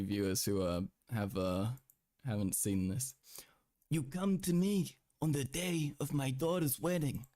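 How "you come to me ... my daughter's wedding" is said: Godfather mobster quote. Retold or imitated. Quoting Godfather line.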